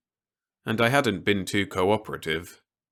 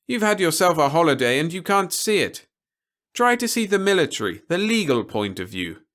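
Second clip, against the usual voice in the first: a lightly raised, measured officer's voice; stern officer; 9 semitones higher, 6 dB louder than usual. A narrator's reading. Officer warning Peter against anything rash, lightly voiced and grave. Officer explains legal point.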